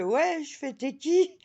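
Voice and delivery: high, loud tipsy answers; tipsy self. Retold or imitated. Tipsy woman not recognising the neighbour, high and loud. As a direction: sound confused and tipsy.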